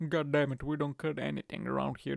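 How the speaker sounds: ordinary delivery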